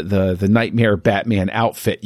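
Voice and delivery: ordinary talk, nothing marked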